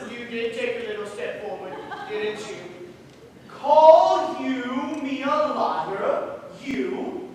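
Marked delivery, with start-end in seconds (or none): 3.5-7.3 slow, loud, offended demand; big man bristling at the stranger; indignant challenge. Acted. Slow and loud, daring the stranger to call him a liar.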